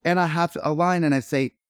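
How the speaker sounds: ordinary talk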